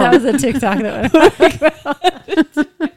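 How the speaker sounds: slow high words through laughter; laughing. Real, not acted. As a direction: drag it out laughing. Laughing at the TikTok.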